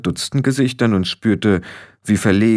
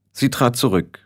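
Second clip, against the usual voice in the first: ordinary delivery, nothing marked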